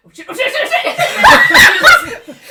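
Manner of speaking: high, loud panicked little voice; frantic high-pitched cursing; little voice panicking. Acted. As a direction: squeal it in rising alarm. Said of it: "you just hear a little voice go".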